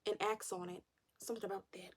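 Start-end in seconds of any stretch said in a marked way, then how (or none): 1.2-1.9 quietly savoring the thought; soft musing. Real, not acted. Vlogger swooning over her man, softly. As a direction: murmur it to yourself, dreamy.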